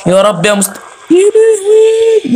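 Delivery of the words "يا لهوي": long, drawn-out, very high wail; frightened wail. Real, not acted. Player startled by the game, wailing. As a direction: stretch it into a terrified cry.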